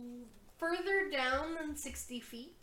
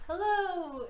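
higher-pitched "Hello!" called down a hole; calling into a pit; 7 semitones higher, 4 dB louder than usual. Acted. Player peering over the pit, calling out hello. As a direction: holler a greeting down into the depths. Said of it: "head over the pit and goes".

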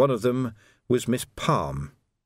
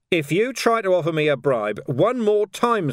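higher, emphatic warning; stern warning; 9 semitones higher, 4 dB louder than usual. A narrator's reading. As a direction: warn him off firmly. Sergeant warns off a bribe.

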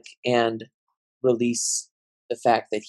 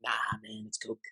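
quiet aside; 2 semitones lower, 14 dB quieter than usual. Real, not acted. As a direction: slip it in under your breath. Joking aside about his drink.

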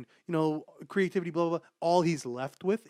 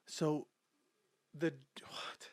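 whispered disbelief; 6 dB quieter, slower than usual. Real, not acted.

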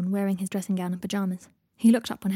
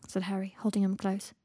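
usual speaking voice

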